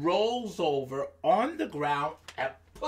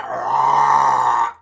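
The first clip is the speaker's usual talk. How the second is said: long, loud, low roar; lion roar; 7 semitones lower, 11 dB louder than usual. Acted. Storyteller showing the lion arriving, roaring.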